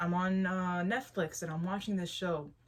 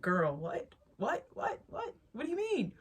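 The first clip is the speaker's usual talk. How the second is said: incredulous; 6 semitones higher than usual. Retold or imitated. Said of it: "I was like".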